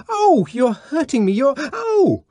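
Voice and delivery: woman crying out as her wrists are wrenched; pained cry. Acted. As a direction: yelp it out as he grips her wrists. Lenina hurt and frightened.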